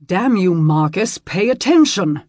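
loud, high furious shout; angry growl. Acted. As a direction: bark it at the careless squire. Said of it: "Robert growled".

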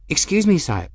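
high, awkwardly polite interruption; trying to sound respectful. A narrator's reading. Young woman interrupting the Spirarch, awkwardly respectful. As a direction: interject respectfully, fumbling the form of address. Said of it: "trying to sound properly respectful".

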